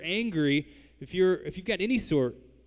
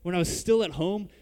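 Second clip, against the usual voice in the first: Jonah's angry complaint; 6 semitones higher, 6 dB louder than usual. Retold or imitated. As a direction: protest to God like a wronged man. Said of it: "you can hear the, the tone of his voice".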